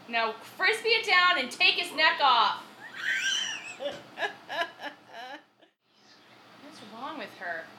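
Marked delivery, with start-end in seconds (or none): 0.1-2.6 higher, louder egging on; fired up. Real, not acted. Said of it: "I'm getting angry". 2.7-4.9 high shrieking burst of laughter. Real, not acted. Laughs at own outburst.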